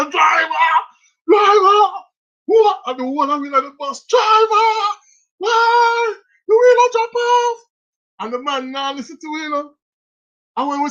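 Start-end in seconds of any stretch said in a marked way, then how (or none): 0.1-7.6 high, loud panicked yelling; screaming girls. Retold or imitated. Girls on the bus screaming at the driver in a panic.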